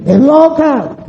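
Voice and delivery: very high, drawn-out mock jeer; burla ajena. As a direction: imitate people name-calling in a stretched high voice. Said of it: "Mejor que digan". (burla imitada, muy aguda y alargada)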